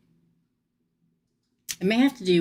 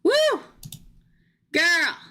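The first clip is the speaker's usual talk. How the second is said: whooping reaction; 11 semitones higher than usual. Real, not acted. Reader reacts to his anger for her client, whooping. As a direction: exclaim high over juicy news. Exclaims over the coming drama.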